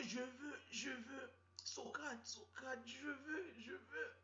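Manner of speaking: high, whining I want; whiny girlfriend. Retold or imitated. Girlfriend begging her boyfriend, high and whiny. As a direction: whine it up high like a needy girlfriend. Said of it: "La go dit que".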